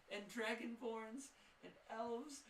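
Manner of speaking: ordinary talk, nothing marked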